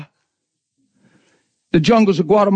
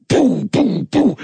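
mock babble; much slower than usual. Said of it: "it was just words like".

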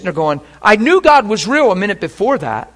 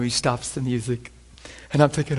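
ordinary talk, nothing marked

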